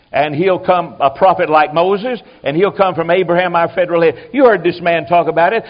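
usual speaking voice

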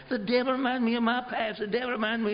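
high, far-off nagging whine of a caller; whiny caller mimicry. Preacher mocking a caller who whines about her past in a high whine. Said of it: "like talking to a fence post".

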